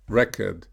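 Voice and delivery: record with a strong first syllable, REC-ord; the noun record, stressed up front. Shown as a demonstration. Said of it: "stressed on the first syllable".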